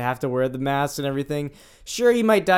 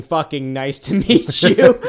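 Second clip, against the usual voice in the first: louder, drawn-out quote breaking into a laugh; laughing mock greeting; 6 dB louder, slower than usual. Retelling what the signing characters really said, laughing and emphatic. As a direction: stretch it out and laugh through the end. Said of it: "at one point they were saying".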